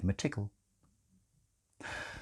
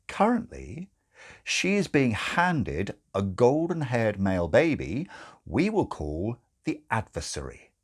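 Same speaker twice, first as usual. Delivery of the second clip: ordinary delivery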